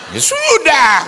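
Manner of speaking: loud, high put-on yell; mock exasperated shout. Maid and employer bicker at home, hammed-up shout.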